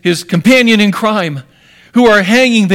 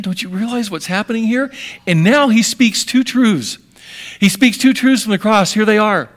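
normal talking voice

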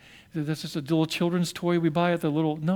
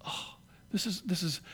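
nearly voiceless, much softer dismay; hushed; 13 dB quieter than usual. Real, not acted. Pastor reacting to the lions' slaughter, hushed. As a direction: drop to a breath at the horror. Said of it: "this is awful".

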